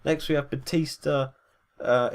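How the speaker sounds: usual speaking voice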